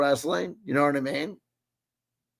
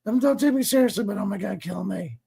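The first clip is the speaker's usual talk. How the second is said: imitating mom; 5 semitones higher than usual. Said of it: "she'll say".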